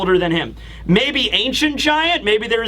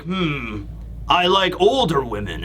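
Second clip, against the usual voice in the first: pondering giant; 6 semitones lower than usual. Retold or imitated. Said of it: "he's thinking about it like".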